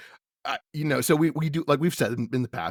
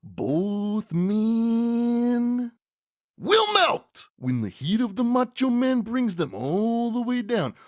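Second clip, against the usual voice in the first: high, stretched words building to a huge catchphrase; Macho Man; 10 semitones higher, 4 dB louder, slower than usual. Acted. Wrestler promising to beat both men, hammed-up triumphant impression. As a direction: draw out the opening, climax in a loud "Oh, yeah". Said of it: "at which point Mach says".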